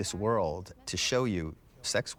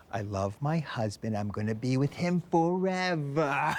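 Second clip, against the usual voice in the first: put-on unguarded Brooklyn accent; 6 semitones higher than usual. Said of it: "it comes out".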